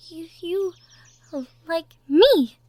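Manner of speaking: stunned, half-laughing disbelief; flustered surprised gasp; amazed question. Acted. Disbelief at the confession.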